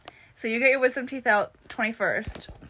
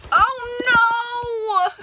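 high, crying-out oh no; theatrical gasp of dismay; mock dismay; 10 semitones higher, 4 dB louder than usual. Real, not acted. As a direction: wail it like a scandal.

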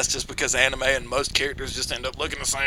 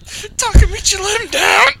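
upset wail; 7 dB louder than usual. Real, not acted. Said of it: "And then now to know that".

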